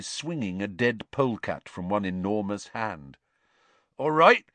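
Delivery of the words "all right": high, loud, eager greeting; keen to share news. A narrator's reading. Hagrid greeting the students, eagerly. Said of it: "he said eagerly".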